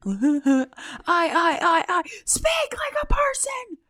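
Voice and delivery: high, loud exasperated squeal; laughing exasperation; frustrated squeal. Real, not acted. Frustrated at own stammering.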